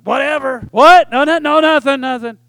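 mock huffy retort, high and loud; passive aggressive. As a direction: act out a defensive parting jab. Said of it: "somebody who might be passive aggressive".

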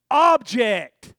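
loud laughing shout of object; mock shout. Preacher acting out his old view, loud laughing shout. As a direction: bark it out with a laugh.

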